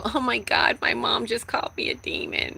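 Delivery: high, hushed mock whisper; high whispered mock shock of a child. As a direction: whisper it as a scandalized kid. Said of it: "They don't go".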